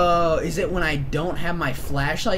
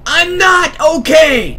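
yelling in real panic, slow and loud; panicked shout; 13 dB louder, much slower than usual. Real, not acted. Gamer yelling at his friend in a horror game, panicked. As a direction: shout it, you are not fine.

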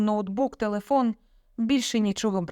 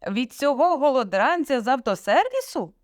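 higher-pitched indignant question; raised voice; 6 semitones higher, 4 dB louder than usual. A narrator's reading. Father questioning daughter about her boyfriend, raised voice. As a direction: raise your voice in scornful disbelief. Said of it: "підвищив голос".

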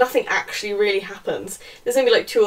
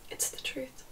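hushed, voiceless mimicry; imitating him in a whisper; mock whisper; 3 semitones higher, 17 dB quieter, slower than usual. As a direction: whisper it as a mocking quote. Acting out his line mockingly.

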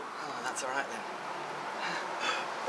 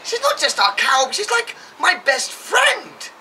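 shocked protest; 9 semitones higher than usual. Acted. Son protests selling his cow.

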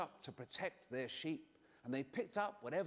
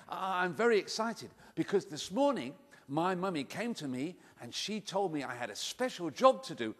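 usual speaking voice

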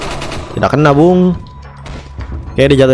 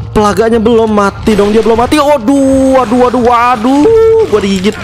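high, panicked yelping; 10 semitones higher than usual. Real, not acted. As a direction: cry out in real panic while bitten. Bitten by parasite in game.

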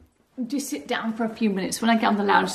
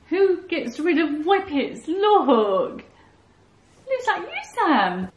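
higher dog talk; 6 semitones higher, 5 dB louder, slower than usual. Real, not acted. Owner tells her whippet the photo looks like him, higher. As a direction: coo it to the dog.

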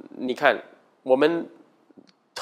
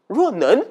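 若能 asked high and loud with a sharp rise; incredulous question; 8 semitones higher, 6 dB louder than usual. Shown as a demonstration. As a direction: fling back 若能 as a doubting question. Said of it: "问号。".

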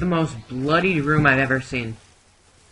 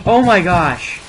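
startled; 4 semitones higher, 9 dB louder than usual. Real, not acted. Sudden scare in horror game.

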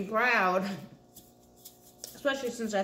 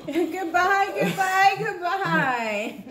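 high, louder singsong farewell with laughter; sing-song goodbye; 9 semitones higher, 6 dB louder, much slower than usual. Retold or imitated. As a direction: chirp it out like a mocking goodbye. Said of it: "and now you're like".

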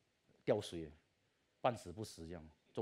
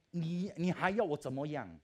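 exasperated plea; 6 semitones higher, 5 dB louder than usual. Retold or imitated. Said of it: "我讲".

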